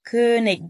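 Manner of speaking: كونيج as König ending in a hard g. Shown as a demonstration. Teacher shows the allowed variant, final hard g. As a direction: close كونيج on a clicked g, not sh. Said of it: "بالإي جي".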